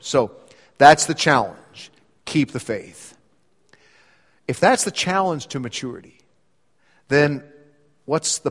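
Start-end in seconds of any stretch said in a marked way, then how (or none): 2.3-3.2 low and soft, almost to himself; a soft, lower restatement of Keep the faith; quiet echo. Acted. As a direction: let the charge settle gently. Preacher repeating the charge softly.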